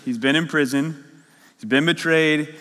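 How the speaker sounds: ordinary delivery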